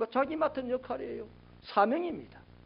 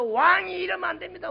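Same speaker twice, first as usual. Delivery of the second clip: high, raised objection; mock protest; 9 semitones higher, 4 dB louder than usual. John imagined confronting the king in his palace, protesting. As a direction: call it out as a public rebuke. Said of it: "뭐 이렇게 한 게 아니고".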